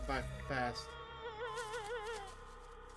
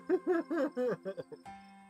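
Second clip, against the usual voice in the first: high, loud burst of laughter; real laugh; 10 semitones higher, 5 dB louder than usual. Real, not acted. Player cracking up at the game, real laughter.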